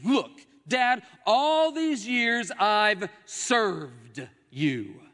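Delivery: loud, high, drawn-out complaint; aggrieved protest. Acted. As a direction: protest to Dad, stretching the words. Older brother's protest to his father.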